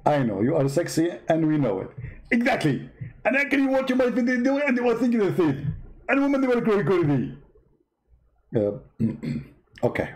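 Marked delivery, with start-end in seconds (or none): 2.3-7.3 high-pitched mock debater voice; a squeaky, smug rival preacher; Zakir Naik impression. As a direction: push the voice way up and lampoon him. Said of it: "Uh, Zakir Naik".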